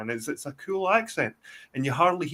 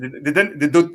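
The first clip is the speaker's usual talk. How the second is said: ordinary talk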